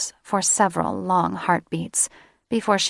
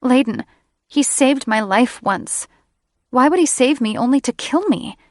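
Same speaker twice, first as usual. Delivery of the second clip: higher and louder, distressed and pleading; upset young woman, higher; 6 semitones higher, 5 dB louder than usual. Acted. Sarah pleads with Layden about her grandfather, higher, louder. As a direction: push it up, close to tears.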